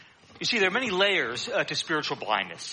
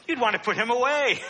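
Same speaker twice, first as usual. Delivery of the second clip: laughing; 7 semitones higher, 3 dB louder than usual. Real, not acted. Laughing through the punchline.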